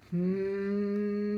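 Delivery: steady humming drone; fridge hum. A narrator's reading. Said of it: "went the refrigerator".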